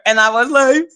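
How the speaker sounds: ordinary delivery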